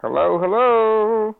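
slow loud calling into the line; drawn-out call for an answer. Real, not acted.

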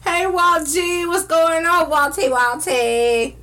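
high, drawn-out sing-song hello; excited call-out. Real, not acted. Host greets a viewer joining live, excited call-out. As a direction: sing out the name high and stretched.

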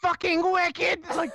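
high, slow furious scream; drawn-out enraged yell; screamed quote. Retold or imitated. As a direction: scream it out like you snapped. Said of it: "You gotta stop screaming like that".

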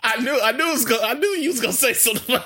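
high, laughing through the words; real laughter, pitched high. Real, not acted. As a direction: crack up while answering. Laughing at friend's teasing.

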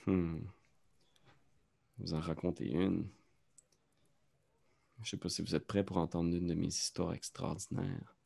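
low and quiet, no showmanship; a deadpan storyteller clown, subdued. Acted. GM back in the clown voice.

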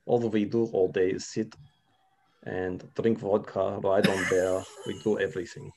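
lightly put-on Russian accent, unhurried. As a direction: play a mock Russian interviewee. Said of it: "give us a, a bit of Russian now".

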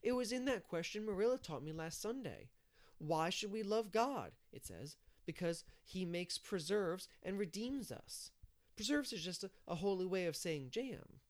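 put-on high, emphatic child voice; Davy, insistent. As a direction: recite and explain with a child's certainty. Narrator voices Davy explaining catechism.

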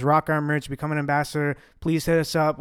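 ordinary talk

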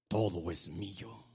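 low, deliberate claim; measured, deep-pitched affirmation; slow, low declaration. Acted. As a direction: state it slowly and low, word by word. Preacher demonstrates claiming, first take.

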